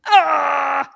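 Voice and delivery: a real, loud, high cry of joy; emotional cry. Real, not acted. Host cheering her guest with a loud, high cry of joy. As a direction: let out an overwhelmed cheer.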